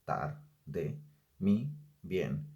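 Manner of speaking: slowly scanning the verse for syllables; slow, deliberate scansion of the verse; slow verse reading. Shown as a demonstration. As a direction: read the line very slowly, word by word. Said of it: "Veamos el ejemplo".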